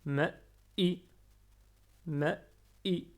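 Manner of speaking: ma i, i appuyé et retenu; shadda appuyée. Shown as a demonstration. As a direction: retenir sur i. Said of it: "on appuie sur le alif". (ma i avec shadda)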